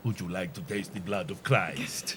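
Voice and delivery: put-on Spanish accent. Mocking the TV priest offering communion, mock Spanish accent. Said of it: "That's my Spanish accent".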